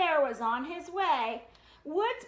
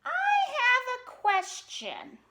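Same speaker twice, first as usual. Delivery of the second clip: cub voice; 7 semitones higher, 4 dB louder than usual. Acted. Brother speaks up.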